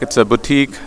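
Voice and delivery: normal talking voice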